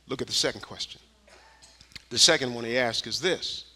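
ordinary talk

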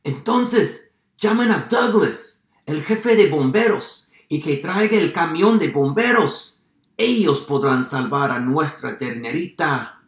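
high, loud urgent orders; shouted command, easing slightly at the end; farmer shouting. A narrator's reading. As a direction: call out for the fire brigade. Farmer's quoted cry for help.

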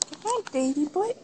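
ordinary delivery

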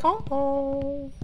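high-pitched oh oh. Acted. Spooky game tension.

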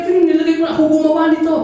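put-on high, loud childlike claim; insistent boy. Boy telling the seller he made the toy, insistently. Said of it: "Sabi niya".

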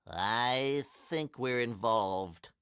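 slow, stretched-out admission; resigned realization. A narrator's reading. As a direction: draw it out with sinking dread. Resigned after refusing to join.

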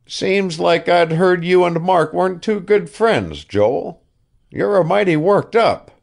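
put-on an old man coaxing, talking up his pet ship; old ship owner Asa. A narrator's reading.

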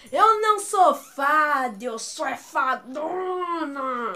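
slow, drawn-out quoted movie line; Kéfera's film line. Retold or imitated. Fan quoting the film, slow theatrical imitation. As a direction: stretch it out theatrically, last word longest. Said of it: "tem uma parte do filme que a Kéfera fala".

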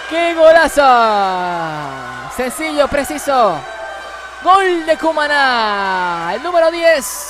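goal call, loud and high; breakneck goal commentary. Real, not acted. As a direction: shout the goal with full joy. (narración de gol a todo pulmón)

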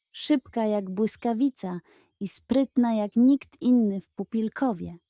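usual speaking voice

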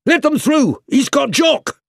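men shouting out to make way; shouted. A narrator's reading. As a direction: yell it across the crowd to clear a path.

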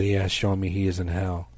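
ordinary talk

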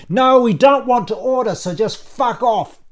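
loud, high irritated snap; angry outburst. Acted. Ian sends the waiter away, angry and loud. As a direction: shoo them away furiously, slowing at the end.

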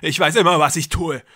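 loud and grandiose, playing a god; deranged brother. A narrator's reading. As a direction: brother lecturing his trapped sister. Jakob voiced in story.

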